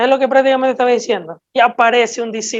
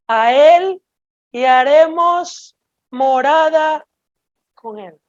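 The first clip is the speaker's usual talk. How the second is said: slow, solemn Bible reading with long pauses; scripture reading; much slower than usual. Acted. Preacher reading the verse to the congregation, slow and solemn. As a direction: lay each phrase of the verse down slowly. Reads John 14:23 aloud slowly.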